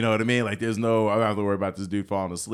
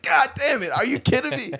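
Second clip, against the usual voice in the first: much higher, outraged disbelief; incredulous groan at a missed payout; exasperated disbelief; 3 dB louder than usual. Retold or imitated. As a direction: kick yourself out loud. Kicking himself over settlement.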